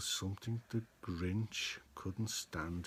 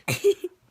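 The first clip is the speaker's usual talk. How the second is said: sudden high, loud cackling laugh; high cackle; 13 dB louder than usual. Acted. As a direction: let out a wicked Grinch cackle. Grinch laugh in story.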